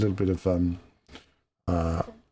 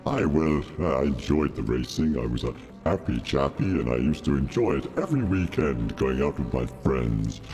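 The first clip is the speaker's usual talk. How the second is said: Darth Vader voice; 8 semitones lower than usual. Acted. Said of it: "little bit of Vader".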